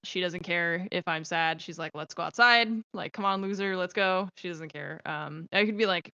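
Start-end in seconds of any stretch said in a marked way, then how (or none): 1.9-4.3 louder, bossy dog voice; voicing the dog. Owner voicing her dog begging to go outside, loud and pushy. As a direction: play the impatient dog nagging its owner.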